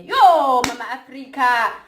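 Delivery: high shouted yo, Mama Africa; loud hail. Retold or imitated. Mocking people greeting natural hair with a loud hail. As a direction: call it out across the street.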